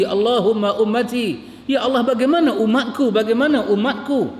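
high, lifted preaching voice full of feeling; impassioned preaching. Acted. Preacher telling the congregation how the Prophet wept, impassioned. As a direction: carry the crowd to the Prophet's tears.

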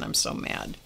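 breathy hush of remembered tears; unvoiced, hushed repeat. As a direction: whisper it like you're crying again. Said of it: "I'm crying".

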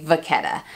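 "vachetta" said va-KET-a; "vachetta" with ch as K, stressed middle; hard K sound. Shown as a demonstration. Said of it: "and I always say".

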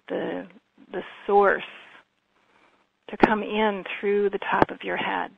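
calm, even meditation voice with long pauses; guided meditation. Real, not acted. Healer leading listeners through breathing and visualization, calm guided meditation. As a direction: guide them gently, leaving space between phrases.

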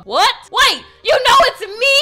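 startled, incredulous questions; shocked disbelief. Acted. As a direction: blurt it out, caught completely off guard. Acted shock at being recognised.

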